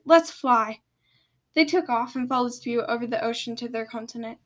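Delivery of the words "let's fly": loud rallying command; shouted. A narrator's reading. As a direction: call out the order to go. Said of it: "Spew shouted".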